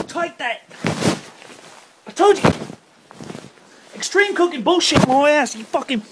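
high loud furious complaining; angry ranting. Real, not acted. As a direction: rant at the cameraman. After eating burning chili.

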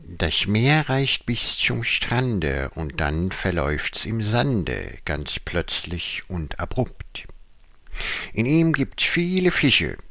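measured, declaimed verse with swooping pitch; poem recitation. Acted. Tiger reciting a fish poem to the audience, theatrical declamation. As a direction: recite it theatrically, pausing after each line.

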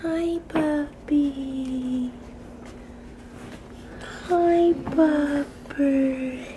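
very slow, soft cooing hello. Real, not acted. Mom softly greeting her just-woken baby.

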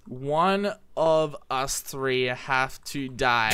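very slow, measured announcement; mock-solemn proclamation. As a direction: spell out the verdict word by word. Mock-solemn announcement to the other players.

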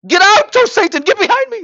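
high, loud rebuke of Satan; mock exorcism shout; rebuking shout. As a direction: cast Satan out with a cry. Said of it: "What should you say to them?".

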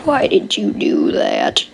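low, slow mock-stern question; deep scolding voice. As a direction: growl it like a grumpy giant. Mock scolding of a game card.

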